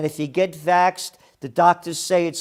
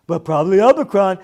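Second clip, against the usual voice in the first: fast, louder childish mocking of the sportscaster's words; 2 semitones higher, 8 dB louder, faster than usual. Host mocks sportscaster's covid line in a childish taunting voice. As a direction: ridicule him like a six-year-old talking. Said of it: "What are you, six years old talking like this?".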